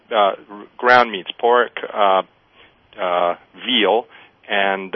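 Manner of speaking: ordinary talk, nothing marked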